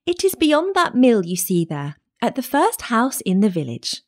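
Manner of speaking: put-on high little-girl voice. A narrator's reading. Child showing the wolf grandmother's house, high girlish voice. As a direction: innocent child giving directions.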